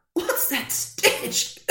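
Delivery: slow, airy coughing question; man in a suit coughing at the smell; coughed out. Acted. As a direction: cough the words out through the stink. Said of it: "coughed a man in a suit".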